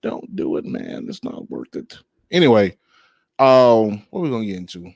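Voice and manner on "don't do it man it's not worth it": hushed, unvoiced urging; whispered cop plea. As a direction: whisper it, pleading, like a cop talking someone down. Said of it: "what the cop did not tell".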